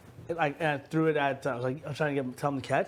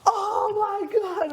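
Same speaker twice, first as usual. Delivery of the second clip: high, slow, laughing "oh my God" like an amazed crowd; crowd gasp; 3 dB louder, much slower than usual. Retold or imitated. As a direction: stretch it out high in mock awe. Crowd reacting to the splash.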